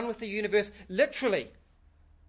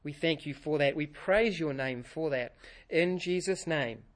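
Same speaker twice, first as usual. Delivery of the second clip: prayer; 4 semitones lower than usual. Real, not acted. Pastor leads opening prayer.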